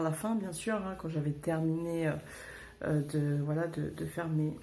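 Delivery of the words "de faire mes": normal talking voice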